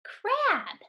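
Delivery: "Crab" said high and bright; cheerful answer. Shown as a demonstration. Teacher to pupils, bright high reveal of the word. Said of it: "What's the word?".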